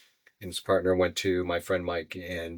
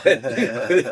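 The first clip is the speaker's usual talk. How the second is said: a quick amused laugh, higher and louder; bright laugh; 6 semitones higher, 8 dB louder than usual. Real, not acted. Laughing at a nurse asking if he's famous. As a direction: laugh it off at the idea.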